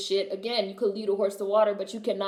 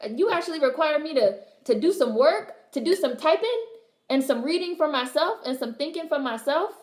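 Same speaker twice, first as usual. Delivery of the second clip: higher, mock-indignant rising questions; mock outrage; 7 semitones higher than usual. Mocking critics outraged at having to read. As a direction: play the put-upon lazy reader.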